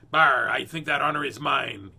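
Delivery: small pirate voice. A narrator's reading. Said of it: "came a small voice".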